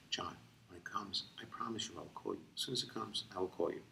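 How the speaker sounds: put-on soft, patient promise to a kid; calm him down gently; patient reassurance. [name] reassuring eager kid.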